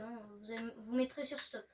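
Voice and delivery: ordinary talk, nothing marked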